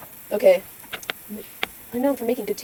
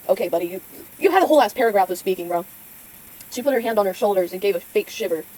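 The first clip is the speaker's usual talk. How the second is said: usual speaking voice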